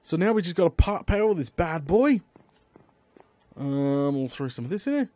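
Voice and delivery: usual speaking voice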